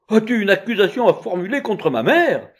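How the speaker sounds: high, loud indignant challenge; furious judge. A narrator's reading. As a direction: demand it in outrage. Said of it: "répondit le juge furieux".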